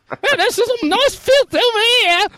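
very high squeaky falsetto; Monty Python quote. Retold or imitated. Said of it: "one of the things I quote".

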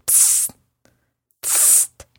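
soft unvoiced hiss; a sharp hush to draw attention; hissed psst. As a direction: catch his ear quietly. Boy hisses to get attention.

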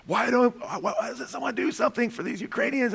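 high, pleading wife's voice. Retold or imitated. Husband voicing his wife in tears over Ukraine, high pleading.

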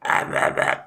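breathy unvoiced lost-voice mumble; voiceless croak. Shown as a demonstration. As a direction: croak it out with no voice at all. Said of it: "post-vocal space if you're like".

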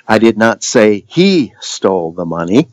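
"he" stretched and emphasized, rest lighter; stress on HE. Shown as a demonstration. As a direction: lean hard on the word "he". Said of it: "the different emphasis".

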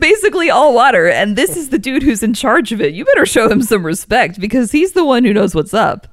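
loud, high, worked-up defense; impassioned rant. Retold or imitated. Fan demanding respect for Aquaman, loud and impassioned. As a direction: build into an indignant fan rant.

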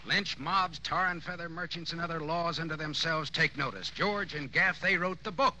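high, mocking speech, rising as it goes; sarcastic tirade. Detective taunting the two avengers, sarcastic tirade. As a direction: pour scorn on them like a showman.

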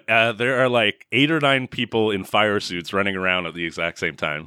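ordinary delivery

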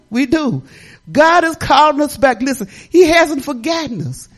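usual speaking voice

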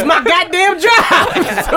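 high, loud, drawn-out protest; indignant outburst. Real, not acted. Heated argument over the drop.

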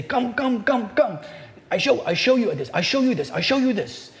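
put-on high, eager Korean-Australian accent, tugging at you; a keen tradesman in a bad Korean-Australian accent; Korean-Australian accent pitched high and urgent. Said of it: "a very bad Korean, Australian accent coming".

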